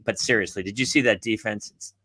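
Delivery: low, soft, confiding undertone; deadpan aside. Retold or imitated. Parent confiding to referee about defense, low deadpan aside. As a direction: drop the voice into mock-serious grumbling.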